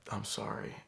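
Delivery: meek whispered apology. Retold or imitated. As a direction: murmur it meekly, holding in a laugh. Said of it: "And then I was like".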